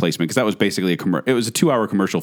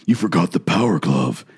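Freddy Krueger; 4 semitones lower than usual. Retold or imitated.

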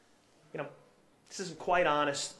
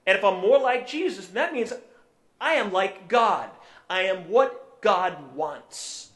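building preaching; 5 semitones higher, 7 dB louder than usual. Real, not acted. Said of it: "preacher builds to his climax".

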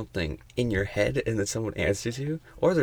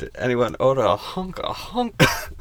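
cheesy seductive line; 3 semitones higher, 5 dB louder than usual. Pretend.

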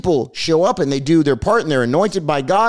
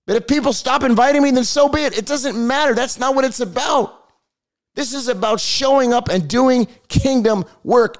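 passionate preaching; 9 semitones higher than usual. Real, not acted. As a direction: pour your heart into it, pitch up. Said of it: "I'm just passionate about this".

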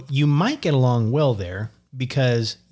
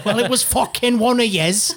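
mock Irish accusation; 9 semitones higher than usual.

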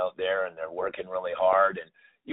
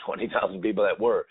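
normal talking voice

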